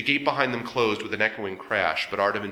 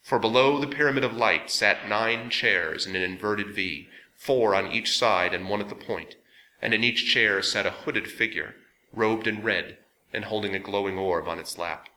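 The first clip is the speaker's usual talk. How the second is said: plain reading voice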